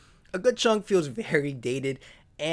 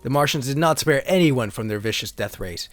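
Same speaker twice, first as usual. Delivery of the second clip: lower, steady storybook narrator voice; story narration; 8 semitones lower than usual. Acted. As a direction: read the card like an old sci-fi serial. Continues card story narration.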